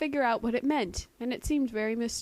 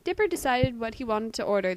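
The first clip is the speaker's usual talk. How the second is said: ordinary delivery, nothing marked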